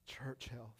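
"Church health" whispered, no voice at all. Shown as a demonstration. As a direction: breathe "Church health" out as a hush. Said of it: "third repeat fades to whisper".

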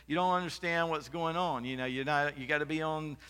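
high, loud put-down from a parent; mock scolding parent. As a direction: tease the out-of-touch listener like an old-school parent. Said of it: "that's how they would say it".

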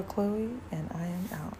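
breathy whisper on the way out; a whispered goodbye to viewers; whispered sign-off. Real, not acted. Signing off softly.

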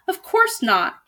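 a dragon crying out, wounded by the accusation; indignant dragon cry; dragon cries out. A narrator's reading. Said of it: "cried the dragon".

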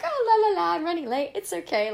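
high, loud sing-song with a laugh; mock her careless younger self; carefree latecomer. Mocking her younger self for shrugging off being late, high and loud sing-song. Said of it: "I would just be like".

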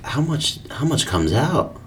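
high disbelieving question; amazed. Real, not acted. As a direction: ask it amazed.

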